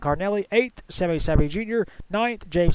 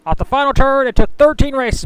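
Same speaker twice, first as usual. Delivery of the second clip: higher and louder as the winner crosses the line; excited finish call; 7 semitones higher, 8 dB louder than usual. Acted. Commentator hailing the winner at the line, excited finish call. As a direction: lift it for the checkered flag.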